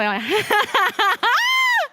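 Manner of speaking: hearty shout of laughter; loud laugh. Real, not acted. Laughs at her own joke.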